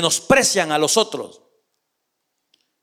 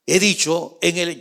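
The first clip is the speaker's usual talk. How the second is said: ordinary delivery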